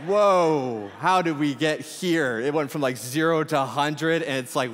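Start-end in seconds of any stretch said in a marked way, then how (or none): 0.0-2.4 higher, louder, slowed mock surprise; hammed-up overwhelmed reaction; startled whoa. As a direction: act caught off guard by too much info. Acted reaction to oversharing stranger.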